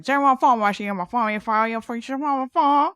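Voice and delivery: put-on thick Southern accent. Retelling a Walmart customer, loud thick Southern accent. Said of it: "He had such a thick accent".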